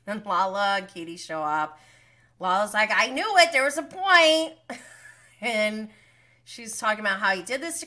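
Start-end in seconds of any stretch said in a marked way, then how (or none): 2.9-4.5 loud, high imitation of an outburst; acted vindicated outburst. Imitating Lala saying she knew it, vindicated outburst. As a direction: throw the quote out high and loud. Acts out Lala's triumphant outburst.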